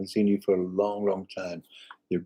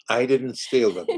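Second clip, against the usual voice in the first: usual speaking voice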